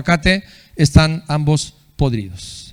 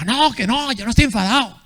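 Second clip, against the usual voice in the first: much higher, softer, huffy insistence; mock angry denial; 7 semitones higher, 3 dB quieter than usual. Someone caught shouting insists he isn't angry, huffy mock denial. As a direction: deny being angry while clearly seething, pitched high. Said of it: "pero dice".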